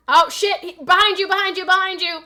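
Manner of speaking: real alarm, high and loud; urgent callout. Real, not acted. Player warning teammate of enemy behind, urgent high shout.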